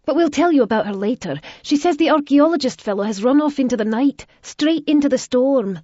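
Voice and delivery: put-on girl rushing urgent news; swiftly. A narrator's reading. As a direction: hurry the news out. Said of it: "Gavina said swiftly".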